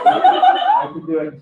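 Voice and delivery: high, slow hammed-up fury; mock rage. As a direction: stretch it out like a madman. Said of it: "I felt real rage".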